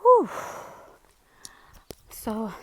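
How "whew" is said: winded exhale after climbing; breathless whew. Real, not acted. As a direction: blow out a tired whew. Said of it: "Sorry, I am out of breath".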